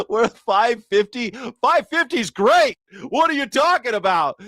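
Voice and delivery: high, incredulous upbeat voice; amused gas attendant. Retold or imitated. Gas guy marvels at five fifty, high mock delight. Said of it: "And he's like".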